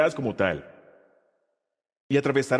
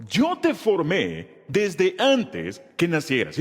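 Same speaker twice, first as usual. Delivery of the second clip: slow, measured reciting for the class to follow; teacher reciting slowly with the class; slow group recitation; slower than usual. Shown as a demonstration. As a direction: lead the congregation word by word. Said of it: "Léelo conmigo".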